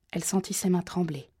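ordinary delivery, nothing marked

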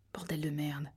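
low, very soft swearing; muttered curse. Acted. Man dismayed by the interruption, muttering low. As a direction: curse under your breath.

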